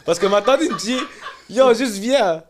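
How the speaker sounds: loud, high, laughing retelling; laughing storytelling. Real, not acted. Telling friends about the aunt, laughing loudly.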